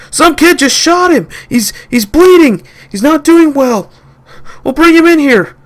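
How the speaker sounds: high, loud frantic report; panicked shouting. Acted. Boys reporting the shot friend: high panicked shouting. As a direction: blurt it out in panic.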